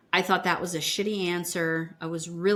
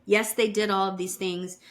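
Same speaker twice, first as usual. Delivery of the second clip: ordinary delivery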